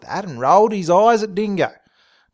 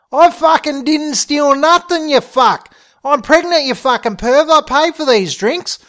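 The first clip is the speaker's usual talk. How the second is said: high, loud outraged rant; yelling ranting; 9 semitones higher, 7 dB louder than usual. A narrator's reading. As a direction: protest at the top of your lungs. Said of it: "yelling at the top of her lungs".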